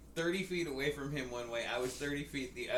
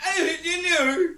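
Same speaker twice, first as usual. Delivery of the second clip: high, loud, slow mock British; hammed-up British accent, drawn out and laughing; 14 dB louder, much slower than usual. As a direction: squeal it in exaggerated British. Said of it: "Oh, that's British".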